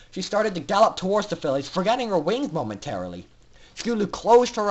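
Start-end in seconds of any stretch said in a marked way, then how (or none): none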